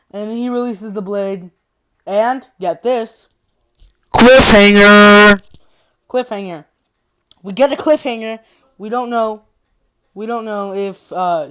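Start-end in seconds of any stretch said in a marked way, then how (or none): 4.1-5.4 very loud, drawn-out shout; dramatic announcement. Real, not acted. Storyteller revealing the ending, dramatic shout. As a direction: belt it out slowly like a big reveal.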